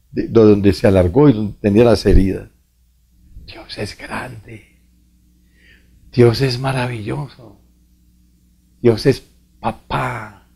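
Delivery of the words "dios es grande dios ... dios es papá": hushed praise. Real, not acted. Priest's quiet closing praise.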